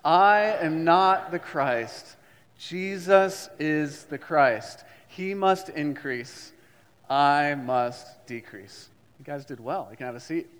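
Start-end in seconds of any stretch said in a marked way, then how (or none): 0.0-8.9 slow, weighted confession; slow creed recitation. Shown as a demonstration. Pastor leads congregation through creed again. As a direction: lean on each word.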